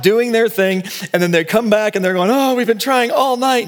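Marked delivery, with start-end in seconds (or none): 2.3-3.7 much higher, a tired moaning complaint; weary whine. Retold or imitated. Fishermen griping to Jesus about empty nets, high weary whine. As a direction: sigh it out like exhausted fishermen.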